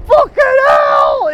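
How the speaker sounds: explosive swearing, high and loud; a sudden furious howl at the loss; furious shout. Retold or imitated. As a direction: yell it out in outrage. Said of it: "you're like".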